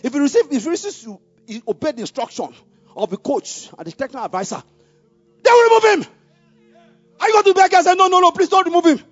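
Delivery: loud, high fervent preaching push; preaching at full force. Acted. Pastor exhorting the congregation, at full preaching force. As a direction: drive the message home to the congregation at full power.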